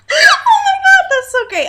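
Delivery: high, loud laughing cry; laughing exclamation. Real, not acted. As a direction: shriek with delight. Cracking up at the joke.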